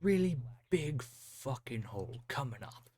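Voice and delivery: slow, breathy whispered repeat; drawn-out teasing whisper. Pretend. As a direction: hush it like a secret joke. Repeats friend's line as whisper.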